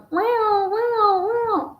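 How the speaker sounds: high, drawn-out fake wow; hammed-up stunned wow; mock amazement. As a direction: gasp it like a clickbait host. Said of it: "just start going".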